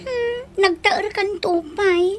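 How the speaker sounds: slow, weepy half-cry; a touch of crying in the voice; mock-tearful wife, slow and choked; teary. Wife tearful over husband's flattery.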